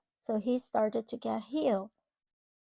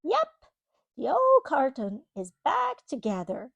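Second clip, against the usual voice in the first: put-on storybook reading to a child; reading to children; 2 semitones higher, 6 dB louder than usual. Parent reading a storybook to a child, gently. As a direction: read it like a bedtime picture book. Reading picture book aloud.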